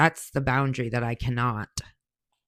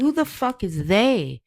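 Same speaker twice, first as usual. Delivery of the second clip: higher, drawn-out mock outrage; indignant retort; 7 semitones higher, slower than usual. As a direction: snap back at the uncle, slow and raised. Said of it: "I said".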